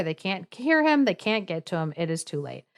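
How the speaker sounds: plain reading voice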